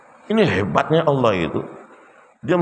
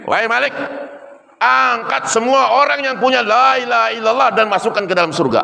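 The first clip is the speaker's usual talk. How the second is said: higher, commanding call to Malik; God orders the angel Malik, raised and commanding; divine command; 8 semitones higher than usual. Acted. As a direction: proclaim the order like a solemn decree. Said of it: "Allah perintahkan kepada malaikat Malik".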